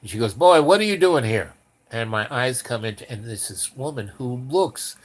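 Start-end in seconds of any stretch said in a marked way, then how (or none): 0.3-1.5 tough biker queen demand. Retold or imitated. Biker queen confronts narrator.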